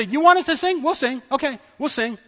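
high-pitched, defiant comeback; challenging retort. Acted. Captives agreeing to sing for their captors, challenging.

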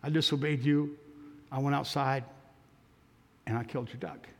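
put-on very soft, broken, teary boy's voice; tearful confession. Ashamed boy confesses to the missionary, softly weeping. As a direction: barely get it out through tears. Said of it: "the tears came".

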